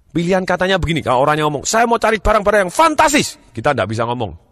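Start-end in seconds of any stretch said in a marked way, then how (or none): 1.6-3.4 loud, high, gushing request; hammed-up enthusiastic customer. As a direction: burst out with big enthusiasm. (pelanggan antusias, lantang bernada tinggi)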